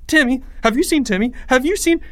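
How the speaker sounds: high-pitched panicked questioning; frantic. A narrator's reading. Leo searching for missing Timmy, frantic. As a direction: ask everyone in mounting panic.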